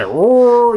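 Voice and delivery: loud, high imitated roar; mock roar. A fan imitating the ship's King Kong roar, loud and high. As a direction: bellow it like a movie monster.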